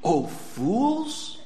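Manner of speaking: soft, gentle "Oh, fools" like a shepherd calling; a caring shepherd's soft rebuke; tender rebuke. Acted. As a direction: call the troubled sheep fools with love. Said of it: "That good shepherd calls his troubled sheep".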